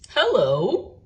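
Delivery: friendly watchman greeting; man's voice, lightly done. A narrator's reading. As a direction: find a bear under the cover. Watchman finds the bear.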